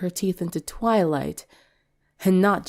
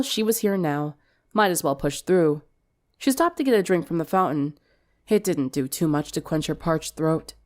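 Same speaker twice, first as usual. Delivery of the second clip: ordinary delivery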